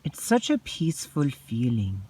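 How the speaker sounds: ordinary talk, nothing marked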